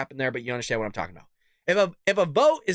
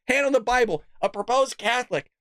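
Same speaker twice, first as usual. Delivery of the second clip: ordinary talk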